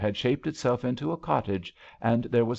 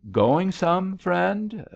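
high, louder call across the dance floor; 10 semitones higher, 4 dB louder, much slower than usual. A narrator's reading. Billy dancing past King calls out gaily. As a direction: sing out to him over the crowd. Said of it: "she called".

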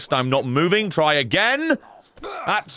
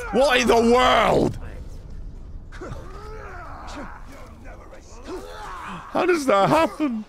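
astonished; 8 semitones higher, 3 dB quieter than usual. Real, not acted. Reacting to a silly spring sound, shouting in high disbelief.

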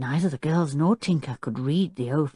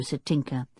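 ordinary delivery

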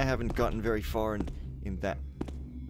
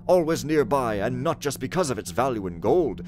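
raised, loud theatrical reading voice; dramatic letter reading; 3 semitones higher, 5 dB louder than usual. Acted. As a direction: read the letter like a stage messenger. Reads in-game letter and text dramatically.

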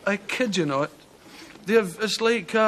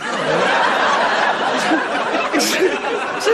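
loud wheezing laugh; 6 semitones higher, 5 dB louder than usual. Real, not acted. Laughing at Scottish Americans' homes.

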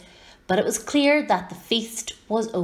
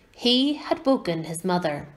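plain reading voice

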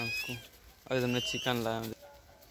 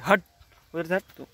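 sharp, higher-pitched shoo; shooing away; 5 semitones higher, 6 dB louder than usual. Real, not acted. Vlogger driving a pestering animal off, shooing sharply. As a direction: shoo the animal off in short bursts.

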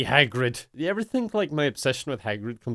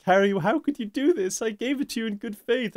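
high-pitched imitation of a wounded mother; betrayed mom; 8 semitones higher than usual. Mother scolding Harry over her spent money, mock betrayed. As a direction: plead reproachfully in a put-on higher voice. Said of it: "She's like".

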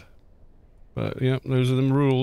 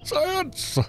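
rambling professor. Retold or imitated. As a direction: squeal it like an old eccentric professor. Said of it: "professor voice going".

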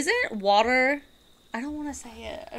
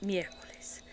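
whispered miércoles; 10 dB quieter than usual. Retold or imitated. Streamer imitating Mirabel's dad, whispering miércoles. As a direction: breathe out miércoles like the Encanto dad. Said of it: "Mirabel's dad, says".

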